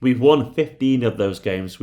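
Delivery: ordinary delivery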